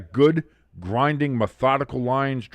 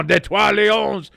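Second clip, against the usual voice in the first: higher, slow "Detroit Lions" as "Tetwal Ionz" in a hammed-up French accent; mock French accent; 9 semitones higher, 5 dB louder, slower than usual. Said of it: "pronouncing the Detroit Lions with a French accent".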